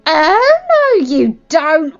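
loud, high, drawn-out challenge; defiant shout. Acted. Maddie blocking the enemy, shouting defiantly. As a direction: cry out to stop them.

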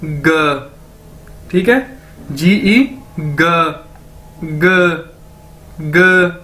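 ग said as a short clipped ga; teaching the class how to say ग, slowly; Chinese ge sound. Shown as a demonstration. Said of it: "जीई को हमने कैसे बोलना है".

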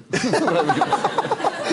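high laugh at the punchline; amused laugh; real laugh. Real, not acted. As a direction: laugh off the anticlimax. Laughing at Disneyland story.

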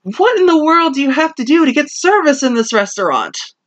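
exasperated diners. Retold or imitated. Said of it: "and go".

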